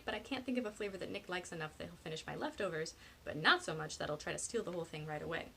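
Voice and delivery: ordinary delivery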